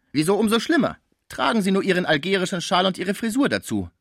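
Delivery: high, loud, scornful retort; indignant doorkeeper woman. Acted. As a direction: fire back as the offended doorkeeper. (empörte Pförtnerin, hohe Stimme)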